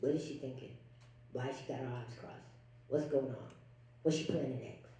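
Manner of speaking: low, soft wondering mutter; puzzled men. Retold or imitated. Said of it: "they're like".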